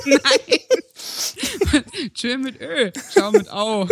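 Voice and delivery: words through giggles, high; laughing reply. Real, not acted. Laughing at her friend's question, giggling through. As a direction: answer while still laughing.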